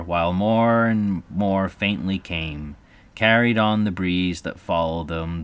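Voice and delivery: usual speaking voice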